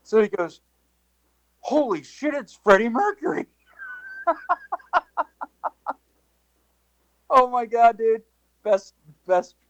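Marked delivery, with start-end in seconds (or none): 1.6-3.5 high, awestruck exclaim; amazed. Retold or imitated. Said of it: "His reaction was". 4.3-9.6 high giggling talk; laughing. Real, not acted. Laughing at the memory.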